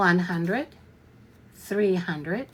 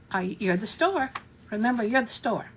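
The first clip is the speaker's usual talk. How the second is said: ordinary delivery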